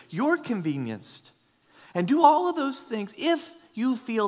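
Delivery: high, loud preacher's exhortation; fervent sermon climax; impassioned preaching. Acted. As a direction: hammer each line at the congregation. Preaching at full force.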